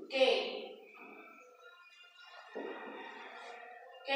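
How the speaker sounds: "K" said the English way, as "kay"; English letter name. Shown as a demonstration. Teacher showing pupils the English letter, "K" as "kay". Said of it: "La K se pronuncia".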